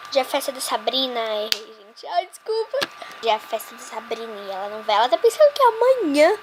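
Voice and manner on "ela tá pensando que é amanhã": gloating. Pretend. Gloating over tricked rival.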